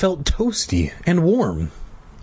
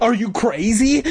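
raised, incredulous angry question; angry cry; 9 semitones higher than usual. A narrator's reading. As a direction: demand it in a higher voice, first outburst. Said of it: "Doctor Gray cried, his face red with anger".

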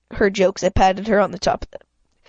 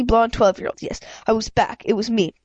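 usual speaking voice

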